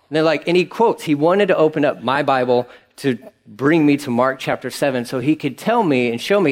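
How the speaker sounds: normal talking voice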